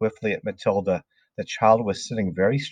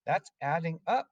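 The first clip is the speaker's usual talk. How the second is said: high, soft schoolboy protest; meek pupil piping up; protesting cry; 7 semitones higher, 7 dB quieter than usual. A narrator's reading. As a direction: object timidly in a high, small voice. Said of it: "Wilfred cried".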